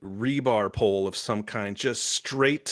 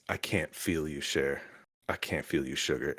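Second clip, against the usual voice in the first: low, soft retold heartbreak; grieving Rogue quote; 8 semitones lower, 7 dB quieter than usual. Rogue holding Gambit after the explosion, hushed imitated grief. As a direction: drop low and quiet, imitating her grief.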